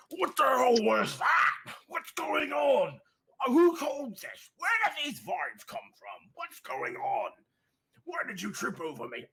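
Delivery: high, panicky orc riders squabbling; bickering orcs. Acted. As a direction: toss blame back and forth like frantic goblin-ish orcs.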